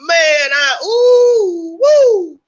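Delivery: screaming way up high, cry breaking into whoops; ecstatic howling. Real, not acted. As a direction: howl with wild glee, voice cracking high. Cheering Nicky D pulling gun.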